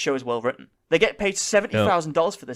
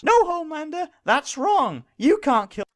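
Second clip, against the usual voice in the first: high, preachy mock outrage; preachy heroine; 12 semitones higher than usual. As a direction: lecture him like a self-righteous hero. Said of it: "make speeches and morally posture".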